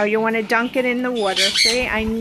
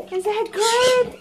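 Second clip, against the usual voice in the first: high cooing pet voice; 8 semitones higher than usual. Real, not acted. Owner cooing to her pig at dinner in a high pet voice.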